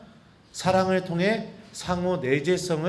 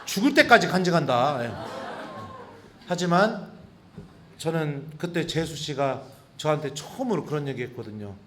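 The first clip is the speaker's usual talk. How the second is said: normal talking voice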